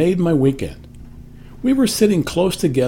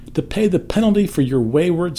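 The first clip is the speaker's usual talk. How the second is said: ordinary delivery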